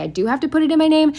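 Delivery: high, fast mocking imitation of grandma. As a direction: parrot her in a high, fast voice. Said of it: "you say".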